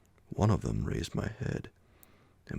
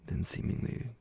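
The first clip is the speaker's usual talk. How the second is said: plain reading voice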